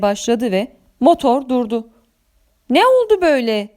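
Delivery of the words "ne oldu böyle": tiz, endişeli soru; endişeyle, sesi yükselterek sor. A narrator's reading. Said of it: "diye sordum endişeyle". (hafif kaygılı şaşkın soru)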